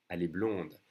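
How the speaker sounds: blonde closed with an audible D; pronounced D. Shown as a demonstration. Teacher modelling the form for a girl, pronounced D. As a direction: sound the D at the end of blonde. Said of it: "you pronounce the D".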